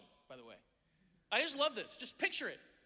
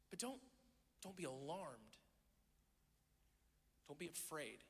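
much softer, calming words; gentle reassurance; 2 semitones lower, 11 dB quieter than usual. Acted. Jesus tells followers not to fear, soft and reassuring. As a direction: settle them down softly.

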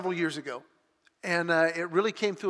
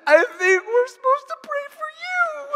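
much higher, crying voice; imitating tearful wife; 7 dB louder than usual. Wife sobbing at her son's college drop-off, imitated crying voice. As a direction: squeeze it out through mock tears. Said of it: "through her total flood of emotions, is going".

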